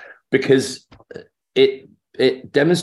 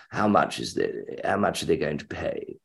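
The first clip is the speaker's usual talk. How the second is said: Peter Wyngarde; 3 semitones lower, 7 dB quieter than usual. Retold or imitated. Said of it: "I said, "Will you please".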